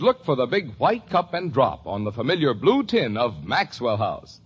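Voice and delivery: ordinary delivery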